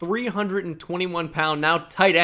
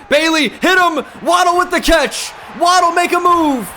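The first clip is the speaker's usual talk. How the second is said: much higher and louder cheering; excited urging shout; 11 semitones higher, 8 dB louder than usual. Real, not acted. Gamer cheering his receiver down the field, excited shout. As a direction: scream him on to make a move.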